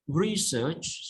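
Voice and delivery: "Research" stressed on the first syllable. Shown as a demonstration. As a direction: put the weight on RE in "Research". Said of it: "Stress on re".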